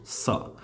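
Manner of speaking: isolated "सा" with clear S; teacher models "सा" for soft C; sound demo. Shown as a demonstration. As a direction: sound out "सा" for the learners. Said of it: "अब देखो force".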